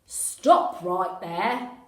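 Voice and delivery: loud, very slow police command; official megaphone voice. Acted. Police officer halting the kids, loud over megaphone. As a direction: boom it out through a megaphone. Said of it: "official sounding voice over a megaphone".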